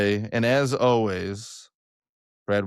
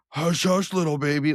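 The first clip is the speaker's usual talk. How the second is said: loud Will Ferrell; 6 semitones higher than usual. Retold or imitated. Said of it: "not being able to control the volume of your voice".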